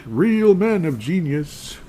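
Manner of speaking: high, slow, grandiose ad-jingle voice; a sarcastic, booming tribute sung out slowly; mock ad announcer. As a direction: ham up the old beer commercial salute. Mocking the beer-ad jingle line.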